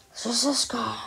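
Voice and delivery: hushed whisper; a scared child whispering about the dog. Acted. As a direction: whisper it so the dog can't hear.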